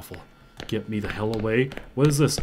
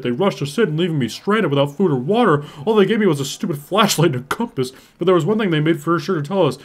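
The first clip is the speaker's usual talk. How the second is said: dramatic louder reading, pushing faster; reading aloud; 4 semitones higher, 10 dB louder than usual. Acted. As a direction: read the note like a desperate survivor. Reads codex entry aloud.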